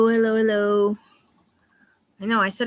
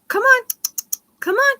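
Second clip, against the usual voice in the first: very high squeaky parrot call, much higher; parrot imitation; 5 dB louder than usual. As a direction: chirp it way up high like the bird. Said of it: "she does go".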